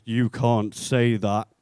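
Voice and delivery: very slow, loud telling-off; mock scolding. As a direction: spell out the objection word by word, imitating offence. Offended girl scolds him.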